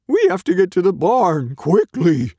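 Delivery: loud, high, urgent dog voice; barking dog. A narrator's reading. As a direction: bark out an order as a dog. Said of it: "barked Dog".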